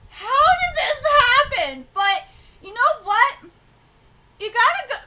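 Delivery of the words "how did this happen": freaked-out yell. Retold or imitated. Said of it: "I'd be like".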